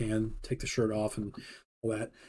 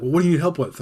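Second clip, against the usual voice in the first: retold boss demand, higher and louder; imitating frustrated boss; 5 semitones higher, 5 dB louder, faster than usual. Head chef pressing a busy cook, mock frustration. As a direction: push the question out like a frustrated chef. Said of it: "he's getting more and more frustrated".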